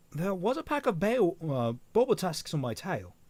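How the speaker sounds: put-on fumbled Australian accent; attempted Australian accent. Velvet warning her partner of a pack, in shaky Australian accent. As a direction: have a rough go at Australian. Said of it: "work on my Australian accent".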